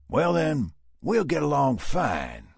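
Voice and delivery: slow, loud Texan drawl; Southern drawl. A narrator's reading. Dallas to Sam, Southern drawl. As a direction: settle it like a cowboy.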